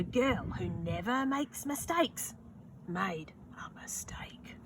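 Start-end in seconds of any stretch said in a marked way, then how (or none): none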